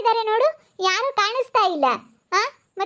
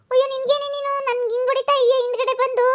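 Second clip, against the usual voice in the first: higher, strained outraged cry; outraged complaint; 5 semitones higher than usual. Acted. Neighbour crying out that she was hit from behind. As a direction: wail at her in indignation.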